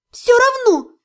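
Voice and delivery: high-pitched stubborn insistence. A narrator's reading. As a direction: dig in, voice pitched right up. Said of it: "упрямо, но уже тише".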